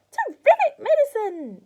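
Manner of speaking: high, loud screeching crone; crying out. A narrator's reading. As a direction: cackle it out greedily. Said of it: "she cried".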